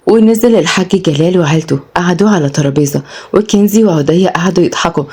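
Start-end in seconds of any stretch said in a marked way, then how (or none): none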